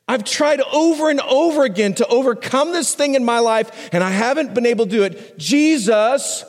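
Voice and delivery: high, slow pleading prayer, close to tears; desperate plea. Acted. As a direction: cry out to Jesus as if at the end of your rope. Pleading prayer at sermon opening.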